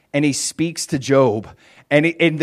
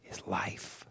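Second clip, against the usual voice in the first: whispered, breathless emphasis; 7 semitones lower, 20 dB quieter than usual. Real, not acted. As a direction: hush it to a whisper. Preacher drops to whisper.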